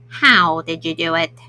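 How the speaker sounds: low-voiced storybook turtle. Turtle asking the race winner, in a low voice. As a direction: ask it in a deep character voice. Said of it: "Turtle asks".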